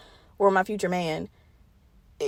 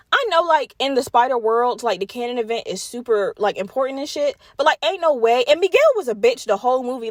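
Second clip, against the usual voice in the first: usual speaking voice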